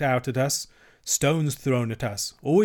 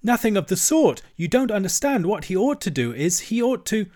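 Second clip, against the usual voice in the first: high-pitched, insistent argument; heated shouting; 8 semitones higher, 3 dB louder than usual. A narrator's reading. Mole overrules Rat about Toad, heated shouting. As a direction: argue over the others, pitch raised throughout. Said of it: "shouted the Mole with his mouth full".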